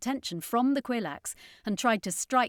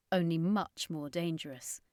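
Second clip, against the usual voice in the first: plain reading voice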